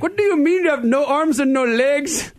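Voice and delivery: put-on high, incredulous Indian-accented caller; baffled scammer in a put-on Indian accent; exasperated imitated Indian voice. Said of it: "with a strange Indian accent".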